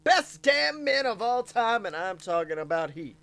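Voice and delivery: loud, high ring announcer hype. Acted.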